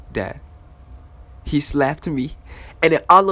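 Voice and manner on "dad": softer, lower little plea to a father; small, hushed mock-innocent call; innocent appeal. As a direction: imitate a kid meekly getting dad's attention. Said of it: "you know, that innocent tug".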